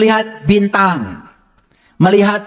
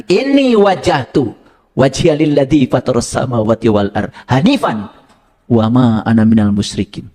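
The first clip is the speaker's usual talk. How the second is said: Quranic recitation; 4 semitones lower than usual. Acted. Recites Quran verses aloud.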